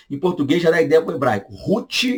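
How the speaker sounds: ordinary talk, nothing marked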